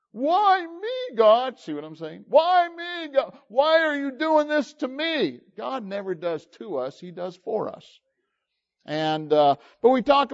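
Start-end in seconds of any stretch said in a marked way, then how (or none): 0.1-5.4 much higher, whiny why me; mock the self-pitying believer; hammed-up whining plea to God; whining complaint.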